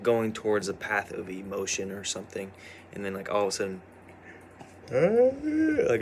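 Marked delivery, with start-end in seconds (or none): 4.9-6.0 word swallowed by a real yawn; high stretched yawn on the word; yawning mid-word. Real, not acted. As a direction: let a yawn take over the word. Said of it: "I went into a yawn".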